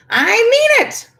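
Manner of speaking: high, slow, loud holler; hollering witch. A narrator's reading. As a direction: drag out a shrill-free holler of warning. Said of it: "she hollered".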